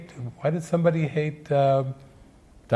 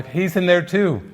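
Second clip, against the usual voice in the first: ordinary delivery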